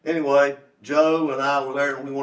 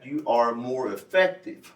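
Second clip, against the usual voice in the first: ordinary talk, nothing marked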